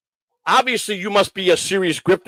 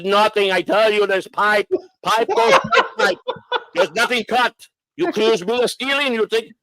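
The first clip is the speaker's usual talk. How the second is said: put-on higher Polish-accented protest; 5 semitones higher than usual. Inspector denying he stole the converter, Polish accent. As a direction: imitate an offended Polish mechanic, pitched up. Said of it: "he sounded Polish to me".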